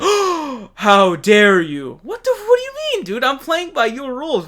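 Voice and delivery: high, drawn-out "how dare you", loud and theatrical; hammed-up mock outrage. Mocking the DNC's feigned outrage, in a high, drawn-out, theatrical voice. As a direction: overplay a scandalised gasping protest.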